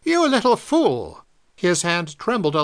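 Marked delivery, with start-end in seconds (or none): 0.0-1.2 higher, slow, shaken scolding; scolding old druggist. A narrator's reading. Druggist scolding girl who nearly died, high slow scolding. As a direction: scold her like a frightened old man. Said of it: "Turner exclaimed".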